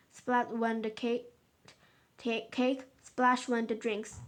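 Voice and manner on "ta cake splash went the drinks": ordinary delivery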